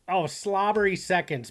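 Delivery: ordinary talk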